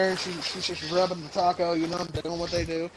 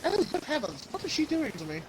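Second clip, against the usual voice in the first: mock shocked gentleman; 8 semitones higher, 3 dB quieter than usual. Mocking the video actor's shifting voice.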